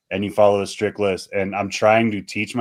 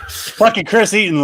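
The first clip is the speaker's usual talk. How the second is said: high, loud fake exasperation; mock exasperation; 12 semitones higher, 5 dB louder than usual. Real, not acted. Guest mockingly announcing the host to the audience, exasperated. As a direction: throw the name out in mock despair.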